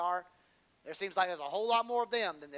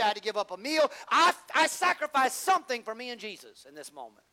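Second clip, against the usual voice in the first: loud, high sermon build; preaching at full force; 7 semitones higher, 10 dB louder than usual. Acted. Preacher urging the church to sacrifice, loud and high. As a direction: preach it out, building each line.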